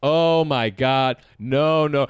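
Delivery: drawn-out, falsely humble protest; mock false modesty. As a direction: stretch every word in showy modesty. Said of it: "Classic guy like this who's like".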